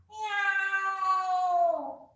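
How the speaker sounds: long, high Halloween-cat meow; kitty cat meow. Shown as a demonstration. Teacher rounding the spine like a cat, high meowing. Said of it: "kitty cat".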